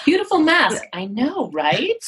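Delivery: playful self-compliment. Pretend. Complimenting herself to her co-host, playfully. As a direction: gush at the mask, then own it.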